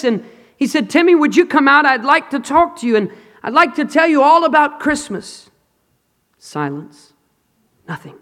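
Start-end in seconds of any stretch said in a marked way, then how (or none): 0.9-5.5 put-on higher, coaxing call to the boy; preacher coaxing the frightened orphan. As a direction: call down under the bed, pleading. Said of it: "after calling out from above".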